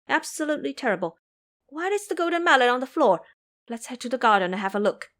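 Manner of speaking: put-on boy hurrying to check the garden; alarmed and hurried. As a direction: rush the words in alarm. Ming Yue alarmed, hurrying.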